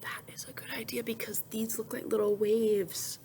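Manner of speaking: quick hushed whisper; whispered aside. Acted. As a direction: whisper the explanation.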